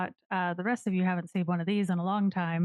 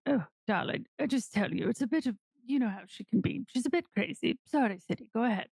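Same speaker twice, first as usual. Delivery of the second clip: put-on darling-drawling character accent, slightly higher; Morty's accent; 4 semitones higher than usual. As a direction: put on an accent, pitched a little up. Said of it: "what is Morty's accent".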